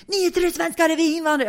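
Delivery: loud, high-pitched complaint; agitated neighbour. Retold or imitated. Neighbour fending off complaint at the door, agitated. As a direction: cry it out as the worked-up neighbour.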